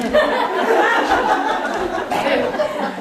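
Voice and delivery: a squealing high laugh bursting out; high giggle. Real, not acted. Speaker to audience about onlookers, high giggling laugh. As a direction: giggle helplessly at the thought. People watching you in the house.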